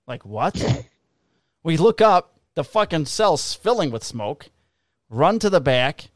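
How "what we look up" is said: normal talking voice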